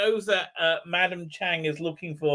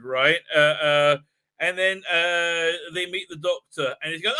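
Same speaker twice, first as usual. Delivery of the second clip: ordinary talk, nothing marked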